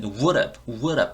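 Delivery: "would have" run together as "wour-ab"; fast linked pronunciation. Shown as a demonstration. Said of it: "발음이 빨라지면". ("would have"를 "워럽"처럼 이어서)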